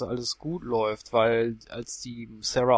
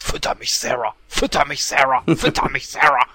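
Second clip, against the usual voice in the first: demanding fridge voice, growing louder; talking fridge; 6 dB louder than usual. Retold or imitated. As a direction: chant it like a hungry fridge calling. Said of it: "dass der Kühlschrank mit ihr spricht". (fordernder Kühlschrank, immer lauter)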